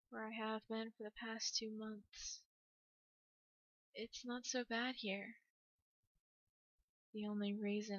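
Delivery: hushed, subdued narration with long pauses; horror story narration. A narrator's reading. Inmate recounts arrest and asylum, hushed horror narration. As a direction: wind the tale down quietly and bleakly. Reads story's bleak ending.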